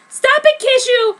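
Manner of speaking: very high, loud protest. A narrator's reading. Heroine pushes Kishu away, loud high protest. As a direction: cry out for him to stop.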